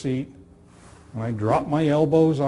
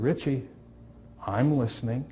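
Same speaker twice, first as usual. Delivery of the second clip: calm reassurance; 3 semitones lower, 7 dB quieter than usual. Retold or imitated. Calm reply to suicidal kid.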